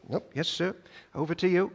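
meek obedient weather. Pretend. Preacher voicing the weather meekly obeying Jesus. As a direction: cave in meekly to the boss.

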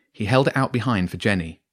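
usual speaking voice

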